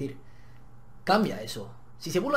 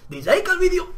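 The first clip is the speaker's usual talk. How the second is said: mock demand; 10 semitones higher, 6 dB louder than usual. Joking demand to viewers.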